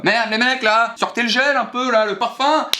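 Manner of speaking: voix très aiguë et enjouée, on chauffe les potes; hyped call to friends. Acted. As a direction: crie aux copains de se préparer, tout excité. Pumps up friends before going out. (appel surexcité aux potes avant la soirée)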